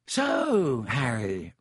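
Lockhart voice. Acted. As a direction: savour the title vainly.

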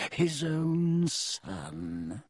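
very slow, sneering contempt; slow nasty sneer. A narrator's reading. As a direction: savour each word, nastily. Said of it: "smiling still more nastily".

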